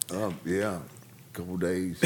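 soft, slow mock-stoned answer; stoned reply. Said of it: "so high I couldn't even see".

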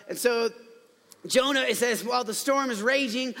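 ordinary talk

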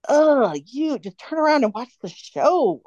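annoyed scolding fan. Retold or imitated. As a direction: play the rude fan hissing at the one in front. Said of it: "not the".

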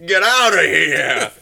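loud, slow shout; hammed-up get-out bellow; mock yell. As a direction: bark them out of the room. Chasing kids off.

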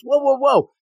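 high-pitched retold objection; mock alarmed protest. Boy stopping her pulling his tail, mock alarm. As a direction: act out a startled boy calling a halt.

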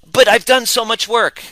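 high, loud whine of a frustrated client; whiny client. Retold or imitated. Coach mimics clients who insist they've done the work, high whine. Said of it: "And then they go".

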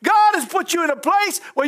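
fervent church preaching; preaching at full force. Acted. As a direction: lift it like a revival sermon. Preaching builds.

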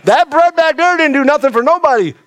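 high, ringing declaration; a touch of preacher fire; fired-up preaching. Retold or imitated. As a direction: proclaim it high and hard. Preacher voicing Jesus, fired up.

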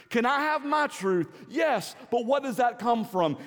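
high, loud preaching, building to a shout; fervent pulpit crescendo; full-force preaching. Acted. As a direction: preach it out with rising fire.

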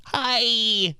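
high, drawn-out mock hello; awkward greeting. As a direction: overdo a socially awkward hi. Mock awkward hello to friend's girlfriend.

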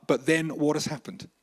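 usual speaking voice

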